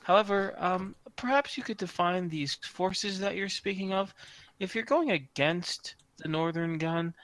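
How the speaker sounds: high, prim, snooty voice; August's snooty high voice. Acted. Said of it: "in his little snooty high voice".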